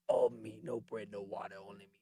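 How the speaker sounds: a low, quiet take on Booker T; hint of Booker T, dropping low and soft; lightly voiced Booker T. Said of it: "the voice of Booker T".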